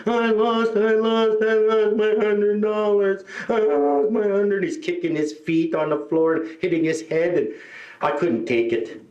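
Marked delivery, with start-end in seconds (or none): 0.1-4.6 high, drawn-out mock wail; imitated screaming child. Kid screams on floor over lost money, mock wail. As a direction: wail it on one high, stretched note. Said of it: "He's screaming".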